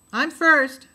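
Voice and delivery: loud high eager claim. A narrator's reading. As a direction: shout it to claim the turn. Child claiming first turn.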